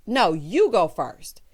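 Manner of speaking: sarcastic slow parrot; mocking repeat. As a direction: drag it out to mock him. Mocking repeat of his line.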